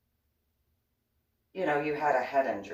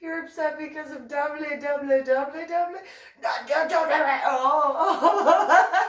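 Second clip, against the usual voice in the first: high giggling teasing about "domly"; laughing tease; 8 dB louder than usual. Real, not acted. Teasing Coyote about "domly" while giggling. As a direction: tease him, barely holding the laughter.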